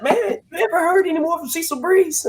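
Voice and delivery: high-pitched imitation of a curious pal; imitated friend. Old friend asks about the caller, imitated high voice.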